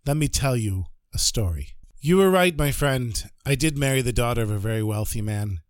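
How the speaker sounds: ordinary delivery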